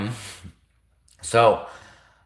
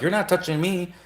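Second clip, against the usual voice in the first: higher, hammed-up recoil, get away; mock disgusted soldier; 8 semitones higher than usual. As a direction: push the freak off in a high mock squeal. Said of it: "soldiers would be like".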